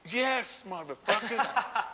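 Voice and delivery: very high, drawn-out exclamation; mock exasperated agreement. Lifter answering form critics, mock exasperated agreement.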